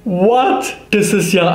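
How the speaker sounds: high startled what; surprised. Real, not acted. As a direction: react in disbelief. Shocked by the strong colour.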